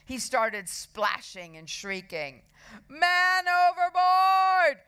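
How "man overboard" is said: high, drawn-out full shout; big acted boy's holler; shouted alarm. A narrator's reading. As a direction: yell it long and loud to raise the alarm. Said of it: "shouted Horrid Henry".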